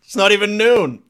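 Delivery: loud, high hammed-up gentleman greeting; Major Swass voice. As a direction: greet him like a grand old officer. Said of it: "Old Major Swass".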